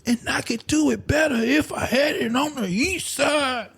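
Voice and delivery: high put-on voice. Mock dreamy artist quote.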